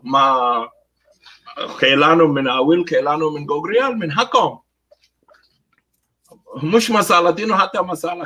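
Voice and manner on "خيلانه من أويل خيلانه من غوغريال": normal talking voice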